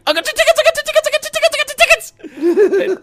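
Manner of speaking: high, loud, gabbled squeal of glee; frantic excitement. Retold or imitated. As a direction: re-enact an overexcited phone call, words tumbling out. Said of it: "took about thirty seconds for me to calm down".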